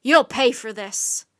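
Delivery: loud, slow threatening yell; furious threat. A narrator's reading. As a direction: threaten with fury. Enraged king threatens.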